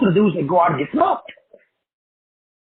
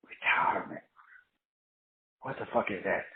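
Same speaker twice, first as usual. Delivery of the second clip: breathy, hushed disbelief, almost no voice; stunned near-whisper; 10 semitones lower, 15 dB quieter than usual. Retold or imitated. Telling a retired friend, hushed in disbelief. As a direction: let the words fall away as if baffled. Mocking retirement, quoting himself.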